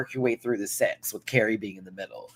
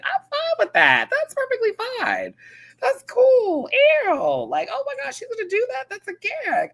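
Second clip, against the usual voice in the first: much higher, airy mock Lydia voice; Lydia's voice. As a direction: squeak it out like an eager young queen. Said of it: "Lydia's like".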